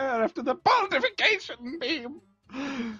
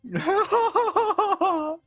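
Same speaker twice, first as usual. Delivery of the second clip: high theatrical villain cackle; a sinister barber's delighted cackle; evil cackle; 13 semitones higher than usual. Acted. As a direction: cackle like a gleeful storybook villain. Villain cackles over drawing.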